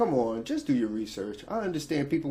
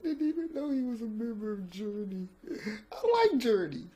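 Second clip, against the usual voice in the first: much higher, soft squeaky laughing voice; 10 semitones higher, 3 dB quieter than usual. Real, not acted. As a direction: squeeze the words out while laughing. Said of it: "can't stop laughing".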